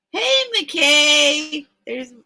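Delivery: loud, high hail to a newcomer; excited greeting. Real, not acted. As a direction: call out a big hello.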